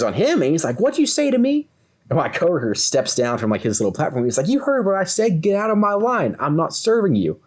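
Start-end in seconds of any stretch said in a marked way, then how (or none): 0.8-1.7 high-pitched retold challenge; mock confrontational challenge. Redneck turns on the coworker. 4.5-7.4 high-pitched retold order, settling at the end; mock angry retort. Coworker answers back in quarrel.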